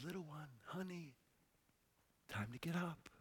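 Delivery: put-on softly, like waking a child; very quiet and gentle; tender and hushed. Jesus to the dead girl, hushed and tender. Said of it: "It's so tender".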